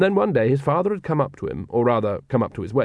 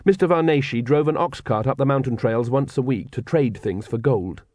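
usual speaking voice

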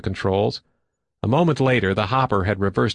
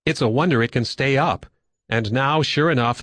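ordinary delivery, nothing marked

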